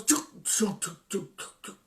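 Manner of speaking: mock engine sputter fading to nothing; car engine noise. Telling how the car broke down in Salford, engine noise dying. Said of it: "And the car goes".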